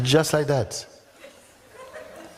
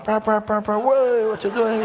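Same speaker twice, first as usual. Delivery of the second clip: high, mock yelling other drivers; mock angry drivers; 9 semitones higher than usual. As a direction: play the annoyed passing drivers, high-pitched.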